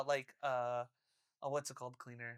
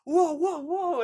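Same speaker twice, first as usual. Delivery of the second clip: staff alarm; 9 semitones higher, 8 dB louder, slower than usual. Retold or imitated. Staff reacting to the vomit.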